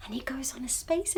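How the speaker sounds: hushed excited whisper. Acted. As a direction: whisper the secret. Sudden whisper.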